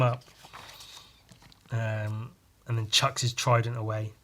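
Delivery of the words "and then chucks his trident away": normal talking voice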